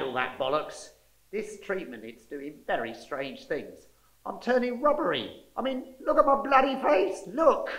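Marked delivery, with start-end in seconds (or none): 4.3-7.7 higher, alarmed complaint. Acted. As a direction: sound rattled and upset. Alarmed at his changing face.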